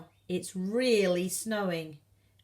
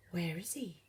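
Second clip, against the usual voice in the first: hushed, breathless whisper; 3 semitones lower, 9 dB quieter than usual. Acted. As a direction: whisper it in wonder. Whispered amid a gasp.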